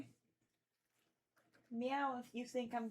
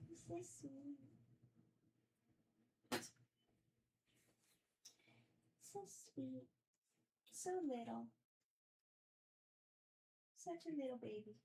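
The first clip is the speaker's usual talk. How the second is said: soft pet talk; 9 dB quieter than usual. Real, not acted. Cooing softly to the cat.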